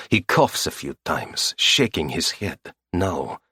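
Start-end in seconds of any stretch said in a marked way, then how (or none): none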